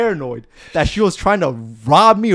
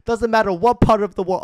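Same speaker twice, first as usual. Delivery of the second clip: ordinary talk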